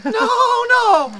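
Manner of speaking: high, loud frantic no; panicked shout. Retold or imitated. Running from the ghost, a loud panicked shout. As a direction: yell it like a horror-movie victim.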